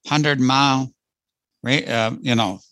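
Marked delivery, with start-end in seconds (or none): none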